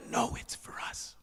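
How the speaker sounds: soft reassuring whisper; hushed whisper; whispered reply. Retold or imitated. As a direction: whisper back to calm him. He goes.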